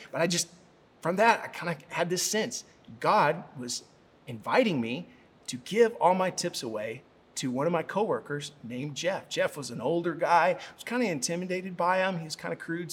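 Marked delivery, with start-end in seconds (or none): none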